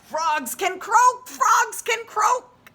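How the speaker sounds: Freddy cheering that he found his sound; happy Freddy. A narrator's reading. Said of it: "said Freddy".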